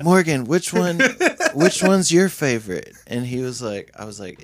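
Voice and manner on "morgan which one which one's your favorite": higher, louder eager ask; excited fan question. Retold or imitated.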